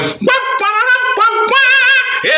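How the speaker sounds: high wah wah like a horn call; trumpet imitation. The horn blown again before the king, high wah wah. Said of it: "he went".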